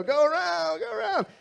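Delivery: hammed-up frantic dad; frantic waving dad. Dad out of gas calls to the cars behind, mock frantic.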